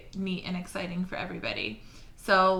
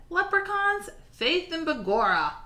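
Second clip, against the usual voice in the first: laughing uncle; 8 semitones higher, 4 dB louder than usual. Acted. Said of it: "Uncle Fergal laughed".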